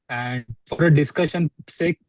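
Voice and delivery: ordinary talk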